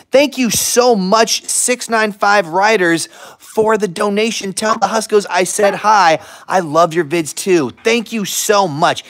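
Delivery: loud, high, bright thank-you to a donor; excited thanks. Real, not acted. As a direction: gush over the donation. Thanking stream donation.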